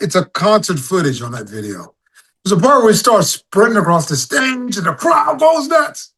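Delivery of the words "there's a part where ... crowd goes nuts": excited. Real, not acted. Excited retelling, crowd goes nuts.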